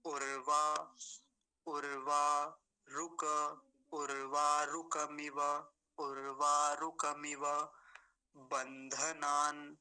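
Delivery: slow, level mantra recitation; word-by-word recitation. Shown as a demonstration. Teacher reviewing all the mantra's words, slow recitation. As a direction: recite each Sanskrit word slowly, every syllable held out. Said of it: "सभी शब्दों का उच्चारण ऊपर से बता के".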